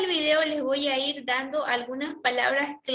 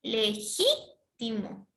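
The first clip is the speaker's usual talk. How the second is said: Legítimo drawn out, GÍ swooping up; slow le-GÍ-timo in pieces; stress on second syllable; 2 semitones lower, much slower than usual. Shown as a demonstration. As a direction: stretch Legítimo and raise gí sharply. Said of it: "Entonces sería ji".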